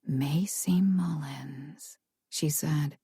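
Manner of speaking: slow, eerie unvoiced whisper. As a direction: breathe it barely above a whisper. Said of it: "her voice barely above a whisper".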